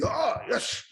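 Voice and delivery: imitated Japanese athlete's yell; mock scream. Imitating guys screaming as they compete, high mock scream. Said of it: "when guys are screaming".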